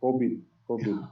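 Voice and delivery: covid said as kobid in a Filipino accent. Shown as a demonstration. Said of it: "in the Filipino accent".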